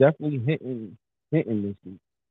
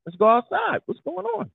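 hyped up; 12 semitones higher than usual. Pretend. Acting hyped for the streets.

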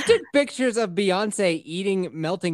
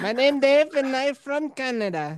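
higher put-on foreign accent, broken English; mock foreign accent; 7 semitones higher, slower than usual. Joking he's a foreign spy, in a high hammed-up fake accent. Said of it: "two spies in the room".

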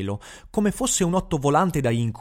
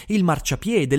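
usual speaking voice

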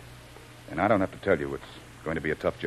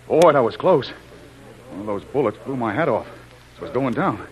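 shaken excitement; 6 semitones higher, 3 dB louder than usual. Acted. Jim just shot at.

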